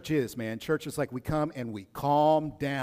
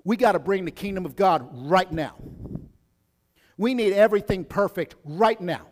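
louder, urgent demanding tone, lightly voiced; voice of culture; 5 semitones higher, 7 dB louder than usual. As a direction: push it like an impatient crowd. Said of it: "culture comes to us and says".

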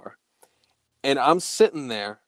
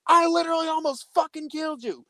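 high-pitched mock outrage; retold irate cop; 14 semitones higher, faster than usual. Irate cop tells the friend he almost killed him, mock fury. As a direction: imitate a cop losing it, voice pushed way up. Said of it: "He is irate at this point".

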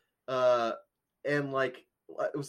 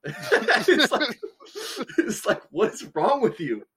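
laughing outrage; 11 semitones higher, 6 dB louder, slower than usual. Real, not acted. Reacting to the Smithsonian reply.

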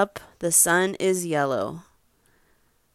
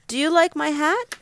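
higher, quick eager question; lightly voiced dog; 9 semitones higher, faster than usual. Parent reads a dog's question to child, lightly voiced higher. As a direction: lightly play a dog asking, pitched up. Dog figure asks in story.

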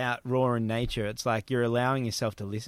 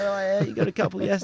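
quoting the locals; 8 semitones higher, 3 dB louder than usual. Retold or imitated. Locals now greeting him warmly.

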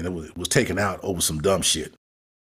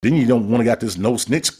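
ordinary delivery

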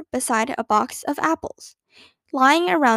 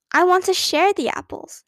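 put-on higher, sweet little-girl offer; sweetly; 6 semitones higher than usual. A narrator's reading. Little sister placating her angry sister, sweetly. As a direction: wheedle sweetly to get out of trouble. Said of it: "she said sweetly".

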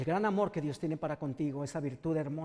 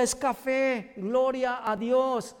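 drawn-out, louder joyful praise; slow awed praise; 6 semitones higher, 5 dB louder, much slower than usual. Acted. Healed blind man praises God at a tree, slow awed joy. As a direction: savour each word in wonder.

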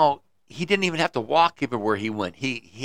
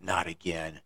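dejected sigh; 5 semitones lower, 9 dB quieter than usual. Acted. Farmer dejected, drops head.

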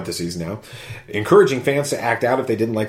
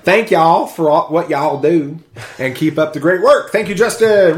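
louder, hearty Southern thanks; Southern accent; 5 semitones higher, 5 dB louder than usual. Acted. Host reading a fan's thanks, hammed-up Southern accent. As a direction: build to a loud Southern shout-out. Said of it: "He must be from the South".

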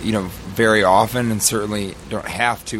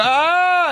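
very high mock scream; sustained high scream of pretend fright; imitated frightened scream; 4 dB louder than usual. Imitates wife's scared scream.

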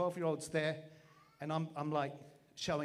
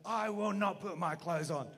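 mild mock shout of refusal; retold defiant shout; 3 semitones higher, 4 dB louder than usual. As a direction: shout back, half-laughing. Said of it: "And I shouted back".

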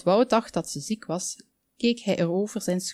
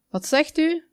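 high-pitched call to repeat; called question; 9 semitones higher than usual. A narrator's reading. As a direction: call out as if you can't hear. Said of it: "riep de, riep de op knoppen".

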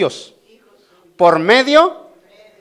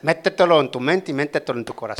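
tender pleading; 5 semitones lower, 5 dB quieter than usual. Real, not acted. Pastor softly pleads with congregation.

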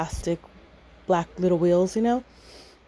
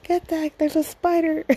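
high, frightened cry to get back; panicked grandma; 10 semitones higher than usual. Retold or imitated. Grandma warning grandpa off the spider, high frightened cry. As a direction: shriek it like a spider just woke you.

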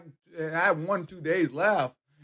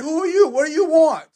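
much higher, louder startled questions; startled patient; 12 semitones higher, 7 dB louder than usual. Retold or imitated. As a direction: voice the sick lady suddenly sitting up, surprised. Revived woman questions him.

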